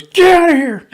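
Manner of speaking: high, loud shout; yelling at bear. Retold or imitated. Grandfather swatting the bear, shouting it away. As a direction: shoo the bear off with a bellow. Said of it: "smacks the bear on the nose and goes".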